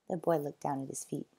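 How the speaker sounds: ordinary delivery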